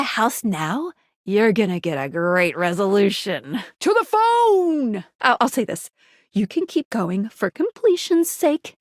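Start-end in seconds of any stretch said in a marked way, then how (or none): 3.8-5.0 high, stretched-out battle cry; rallying cry. Acted. Sending the player to the phone, rallying cry. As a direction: call it out high and drawn out.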